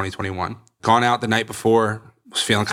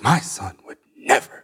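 slow, whispery mock-mom voice; imitating disbelieving mom. Mom defending her son to others, imitated breathy disbelief. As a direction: half-whisper it as an imitated protective mother. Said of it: "My mom would be like".

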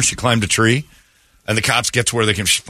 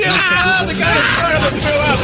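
very high, horrified squeal; grossed-out yelling. Real, not acted. Host watching a vomit video, high grossed-out yelling. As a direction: react in real disgust, pitch shooting up.

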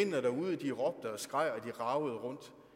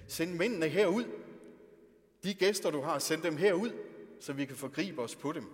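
loud, demanding crowd yell; mob shouting; 5 semitones higher, 7 dB louder than usual. Acted. The townsmen shout at Lot through the door, loud demand. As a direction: bellow it like an angry mob at the door.

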